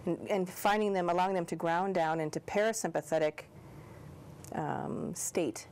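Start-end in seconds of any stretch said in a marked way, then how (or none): none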